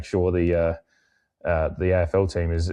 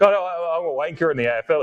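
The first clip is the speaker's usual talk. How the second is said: mocking quote; 9 semitones higher than usual. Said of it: "is essentially what he ended it".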